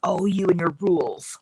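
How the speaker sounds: lightly voiced mom, dismissive; imitating mom. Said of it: "she would say".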